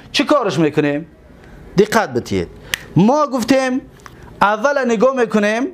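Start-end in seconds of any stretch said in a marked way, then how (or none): none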